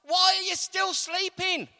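high, loud demand to wake; David pleading for God to wake; urgent plea. Acted. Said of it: "This is what David is doing".

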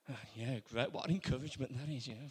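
ordinary talk

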